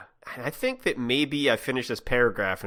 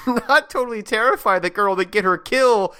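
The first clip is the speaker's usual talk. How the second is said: mock rage scream; 9 semitones higher, 5 dB louder than usual. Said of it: "Diz screamed in rage".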